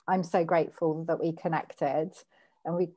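normal talking voice

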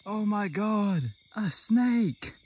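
high, thrilled exclamation; excited. Real, not acted. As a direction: yelp of delight. Sudden snake find.

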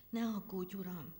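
plain reading voice

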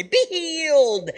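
high, slow, grand mock pronouncement; stretched-out, high theatrical blessing; mock prophet healing. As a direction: ham up a lofty prophet's command. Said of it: "wave his hand over and say".

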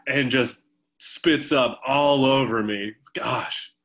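usual speaking voice

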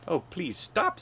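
high, mildly urgent plea; pleading. A narrator's reading. Hubert begs the clipping barber, pleading. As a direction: beg with a high swoop.

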